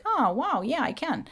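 a hint of an eager Aunt Jenny. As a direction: lightly voice a kooky aunt saying yes. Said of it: "I'm gonna be doing some impressions".

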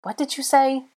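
high-pitched affronted question; offended. A narrator's reading. Draco after being shushed, offended. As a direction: ask it as if insulted.

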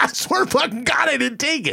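high, insistent denial; pleading protest. Retold or imitated. Retold protest of innocence.

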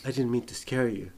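abrupt switch to soft politeness; sudden calm apology. Retold or imitated. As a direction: drop instantly to a gentle apology. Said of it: "from a hundred to, you know, zero".

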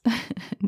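a short, high nervous giggle; nervous laugh. Real, not acted. Nervous giggle before reading the channel to listeners. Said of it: "And I feel nervous".

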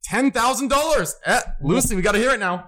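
high, stretched-out announcement of the milestone; thrilled. Real, not acted. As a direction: savour the big number. Charity stream hits ten grand.

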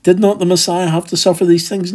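high, fast, scoffing take on "Did not the Messiah have to suffer these things?"; the doubters mocking Jesus's question, high and fast; incredulous mock echo. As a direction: toss back the question as if absurd. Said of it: "They want to say".

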